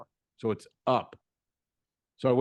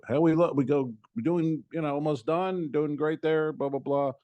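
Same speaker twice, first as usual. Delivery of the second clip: a hint of a chirpy mechanic, higher; breezy salesman patter, pitched up; light mechanic voice; 6 semitones higher than usual. Mechanic reassuring the customer under the car, lightly voiced higher.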